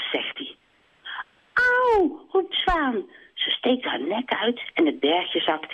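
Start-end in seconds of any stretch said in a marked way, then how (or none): 1.5-2.2 a sudden shrieked ouch; the swan crying out when plucked; swan yelp. Acted. Zwaan yelps in pain.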